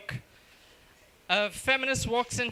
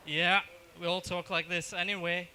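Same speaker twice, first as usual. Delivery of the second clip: ordinary delivery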